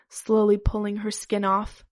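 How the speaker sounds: ordinary delivery, nothing marked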